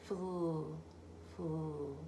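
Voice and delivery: quiet "Flu" blend sounds; soft blend sounds. Shown as a demonstration. Teacher isolating the blend for the child. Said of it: "F L blend".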